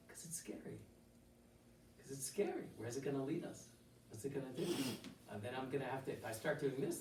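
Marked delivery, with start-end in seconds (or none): 0.1-0.8 hushed whisper, almost no voice. Acted. Teacher whispering to students why change is scary. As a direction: breathe it like a secret fear.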